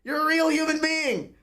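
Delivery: high, near-tearful amazement; teary starstruck disbelief; awed gush. Retold or imitated. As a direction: gush like meeting a hero. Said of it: "I was like".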